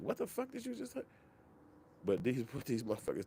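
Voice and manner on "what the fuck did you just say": shocked disbelief. Real, not acted. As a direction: voice shoots way up in disbelief. Reacting to his own quote.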